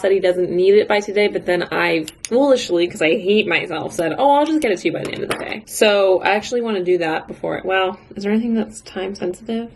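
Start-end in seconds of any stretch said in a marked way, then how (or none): 4.2-5.6 high, fast chirpy mock of herself; eager reply. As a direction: rattle it off brightly, mocking yourself. Mocking her own eager reply.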